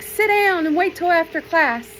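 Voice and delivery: teacher scolding the student, high and sharp; annoyed teacher. Retold or imitated. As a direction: snap a kid back into their seat. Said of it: "She's like".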